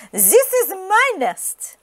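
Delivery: high, slow duck claim; high slow possessive duck. Acted.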